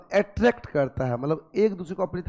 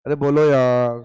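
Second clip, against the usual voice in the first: louder but lower pitched prodding; loud urging; 4 semitones lower, 7 dB louder than usual. Real, not acted. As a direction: push them to speak up. Teacher urging silent students.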